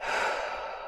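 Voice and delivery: soft, breathy exhale; airy sigh of release; deep sigh. Real, not acted. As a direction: breathe out long and softly. Exhaling before the closing affirmations.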